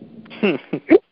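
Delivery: short burst of laughter; amused laugh. Real, not acted. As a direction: laugh at the host's rant. Listener amused by host's rant.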